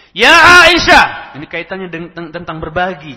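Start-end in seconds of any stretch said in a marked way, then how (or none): 0.1-1.4 panggilan panjang dan keras yang meninggi; seruan lantang. Acted. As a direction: serukan nama Aisyah dengan lantang, ditarik panjang. Nabi memanggil Aisyah dalam hadis. (seruan keras memanggil Aisyah)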